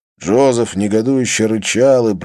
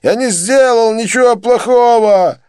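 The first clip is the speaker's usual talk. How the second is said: desperate wail; 14 semitones higher, 6 dB louder than usual. A narrator's reading. Joseph denies guilt to his beaters, wailing. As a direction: wail your innocence.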